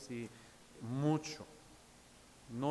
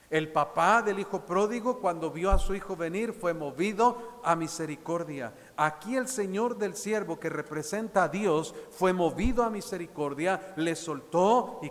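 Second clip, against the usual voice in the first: predicación enfática; 4 semitones higher, 7 dB louder than usual. Acted. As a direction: preach it out, a little louder, building conviction. Preaching to the congregation. (predicador a los hermanos, voz enfática)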